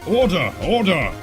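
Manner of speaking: high, firm judge's call; calling for order. Acted.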